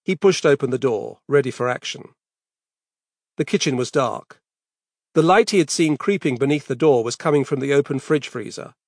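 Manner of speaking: ordinary delivery, nothing marked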